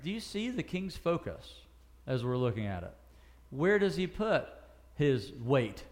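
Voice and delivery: ordinary delivery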